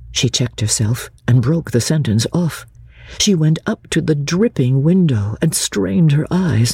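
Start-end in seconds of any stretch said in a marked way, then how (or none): none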